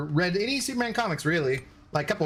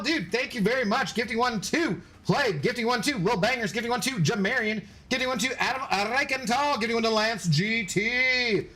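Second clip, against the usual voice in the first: quick, higher shout-outs for each gifter; lively thank-you roll call; 6 semitones higher than usual. Real, not acted. Streamer thanking gift subs, upbeat roll call. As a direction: rattle off the names like a host.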